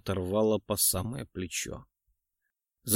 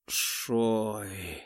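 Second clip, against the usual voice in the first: faint, drawn-out call; weak drawl; 5 dB quieter than usual. A narrator's reading. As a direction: drag the name out weakly. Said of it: "вяло протянул азиат".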